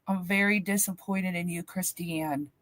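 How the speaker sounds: low, softening retold reproach; stern, disappointed dad. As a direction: imitate a father's grave disappointment. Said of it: "he was mad at me".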